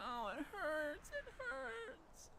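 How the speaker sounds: high, slow, sobbing whimper; crying in pain. Acted. Wounded villager whimpers in pain, crying. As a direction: let the pain break the words.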